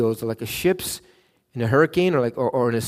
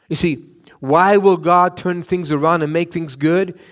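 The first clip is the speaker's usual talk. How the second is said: ordinary delivery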